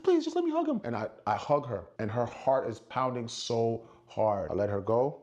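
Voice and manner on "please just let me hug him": high, pleading imitation of a fan; imitated pleading. Fan begs security to let her hug him, pleading high. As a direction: plead in a much higher voice.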